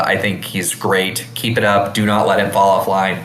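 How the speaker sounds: ordinary talk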